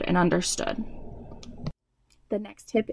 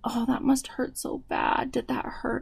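hushed, higher sympathetic whisper; tender whispered comfort, much softer; 5 semitones higher, 7 dB quieter than usual. Retold or imitated. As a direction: breathe it softly to a hurt child. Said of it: "I'm like".

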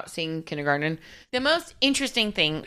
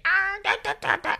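high, laughing put-on kid voice; weird character voice; 11 semitones higher than usual. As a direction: imitate Wesley's nervous silly character. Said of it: "he does a weird voice".

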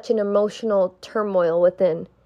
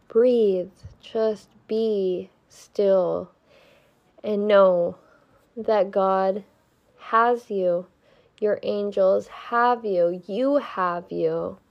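slow soft meditation voice; calm, slow, reassuring guidance; guided meditation; 5 dB quieter, slower than usual. Acted. Guides listener to breathe.

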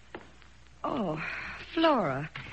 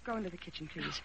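quiet; 3 semitones lower, 11 dB quieter, faster than usual. Acted. Wife sends maid away quietly.

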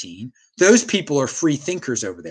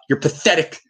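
ordinary talk, nothing marked

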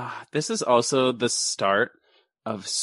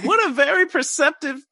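higher, louder wry amazement; mock amazement; 12 semitones higher, 4 dB louder than usual. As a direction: marvel at the kid, hammed up. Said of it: "I'm like".

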